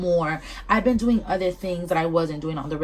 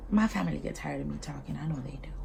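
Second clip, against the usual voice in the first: whispered, much softer, as a confession; whispered aside; 3 semitones lower, 10 dB quieter than usual. Real, not acted. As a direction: let it slip out under your breath. Confiding aside to listeners.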